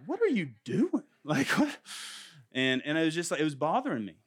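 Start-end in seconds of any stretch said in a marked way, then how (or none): 0.1-1.8 high, incredulous what-are-you-doing; baffled and irritated, pitched way up; annoyed dad. Retold or imitated. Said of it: "And I'm like".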